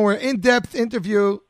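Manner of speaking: ordinary talk, nothing marked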